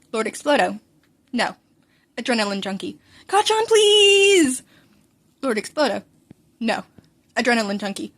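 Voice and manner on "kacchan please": high, loud, very slow whine of please; whining beg to his friend; drawn-out plea. Acted. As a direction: stretch the plea like a begging kid.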